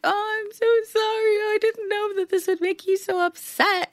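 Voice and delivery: high sing-song mock apology; high mocking imitation of a friend; mocking Rachel. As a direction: ham up her fake sorry, high.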